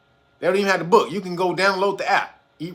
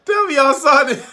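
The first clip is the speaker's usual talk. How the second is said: laughing; 6 semitones higher, 4 dB louder than usual. Real, not acted. Laughing at a viral video.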